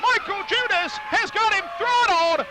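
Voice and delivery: excited play-by-play. Acted. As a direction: call the action with rising excitement.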